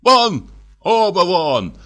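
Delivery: an enraged doctor losing control; furious shout. A narrator's reading. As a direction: drive them both out, shouting. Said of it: "продолжал кричать Андрей Ефимович".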